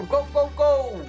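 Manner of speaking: normal talking voice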